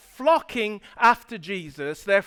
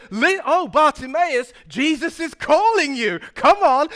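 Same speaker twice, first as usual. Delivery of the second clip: higher, eager calling out; changed crowd attitude; 5 semitones higher, 4 dB louder than usual. Retold or imitated. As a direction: urge him over excitedly. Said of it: "the crowd changes their attitude".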